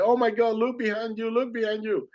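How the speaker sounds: high-pitched alarmed cry, held back in volume; urgent mock scream, high all through; retold scream. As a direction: imitate someone screaming a warning. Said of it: "he started screaming".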